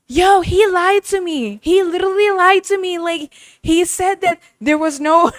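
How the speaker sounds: put-on high, loud indignant girl on a recording; outraged girl complaining she was lied to; mock-outraged high girl voice; upset girl quote. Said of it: "She's like".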